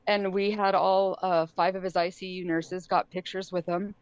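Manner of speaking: normal talking voice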